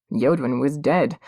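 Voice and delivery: usual speaking voice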